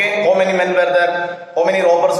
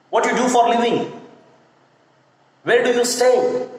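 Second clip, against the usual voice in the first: higher questioning tone; asking a question; 5 semitones higher than usual. Shown as a demonstration. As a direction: ask it as a real question. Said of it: "you should ask, like, a question".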